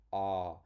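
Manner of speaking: loud open "Ah", tongue flat; "Ah" from the throat with air; saying ahh. Shown as a demonstration. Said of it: "your tongue goes down, stays flat".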